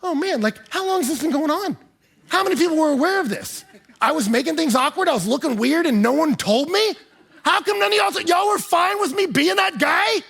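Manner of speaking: high, loud mock dismay; dismayed realization. Pastor reliving realizing he was awkward, high mock dismay. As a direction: pile up the questions in embarrassed disbelief. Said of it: "I'm like".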